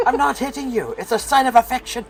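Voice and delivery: high-pitched Scottish protest; Scottish accent. Acted. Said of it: "where the Scottish accent came from".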